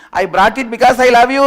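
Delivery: high, loud yell from a distance; acted shout, pitched right up; shouted love confession. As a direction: call it out from far off, ready to run. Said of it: "runs to a certain distance".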